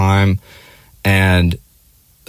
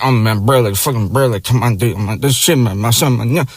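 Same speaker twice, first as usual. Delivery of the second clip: mumbled, slurred bro-talk caricature; mock a dim guy rambling indistinctly; low-IQ dude; 2 semitones higher than usual. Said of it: "this, low I Q, like".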